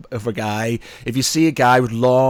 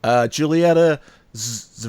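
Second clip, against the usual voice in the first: ordinary delivery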